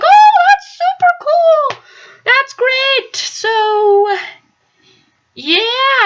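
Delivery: bright, high, overly polite gushing; fake nice. Telling the former tenant politely, high fake enthusiasm. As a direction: gush politely while not meaning it. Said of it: "It was nice".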